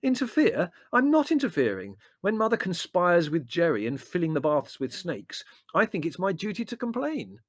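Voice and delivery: high, loud protest; self-righteous, heated objection; indignant retort. A narrator's reading. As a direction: argue back in outrage. Larry retorts in family quarrel.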